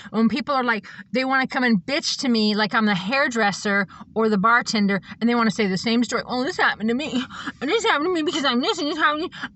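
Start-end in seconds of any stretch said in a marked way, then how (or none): 6.3-9.5 high, hurried mock complaint, rising as it goes; mock whining. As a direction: imitate a whiner piling up grievances. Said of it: "let people whine to me".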